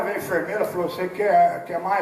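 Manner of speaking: usual speaking voice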